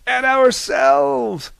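high, loud, slow chorus; group toast shout. A narrator's reading. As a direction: roar "ourselves" together as a toast. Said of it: "toasted the others".

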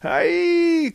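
high group cry; cried out. Acted. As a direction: echo the cry in alarm. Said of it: "cried the others".

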